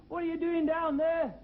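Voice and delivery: calling down to the man under the seat; mock call down. Comedian calls down to the man under his seat, mock loud. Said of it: "I said".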